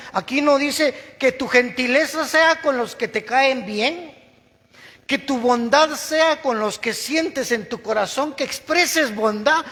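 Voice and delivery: high, loud and slow preaching; fervent preaching. Acted. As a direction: drive the point home to them.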